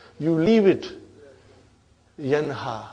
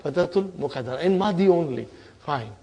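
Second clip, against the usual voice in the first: usual speaking voice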